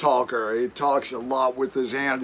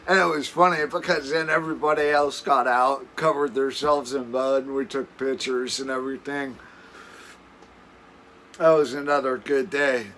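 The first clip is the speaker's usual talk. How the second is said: usual speaking voice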